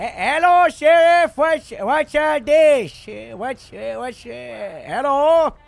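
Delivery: calling out over a loudspeaker; through a megaphone. Real, not acted. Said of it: "Sound like you're on the radio".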